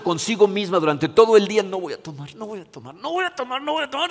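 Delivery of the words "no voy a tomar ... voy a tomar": quiet strained inner voice rising higher; a man fighting himself, voice climbing; struggling addict. Retold or imitated. Imita lucha interna del adicto. (adicto luchando consigo mismo, voz tensa que sube)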